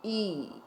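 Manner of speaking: "ई" drawn out and softly falling; sustained teaching model of "ई"; long ee. Shown as a demonstration. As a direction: pull the vowel of "ई" out long. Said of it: "आवाज़ लंबी कर कर बोलते हैं".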